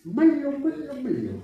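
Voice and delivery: high, mock tapping scold. Pastor mimics a mother gently scolding her son, high and mocking. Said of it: "¿Y usted qué hizo?".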